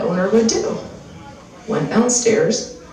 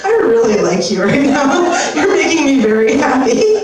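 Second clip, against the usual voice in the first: laughing praise; 6 semitones higher, 4 dB louder than usual. Real, not acted. Comedian praising audience, laughing.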